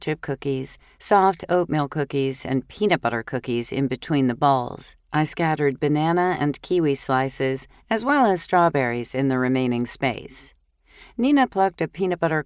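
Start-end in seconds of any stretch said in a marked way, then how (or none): none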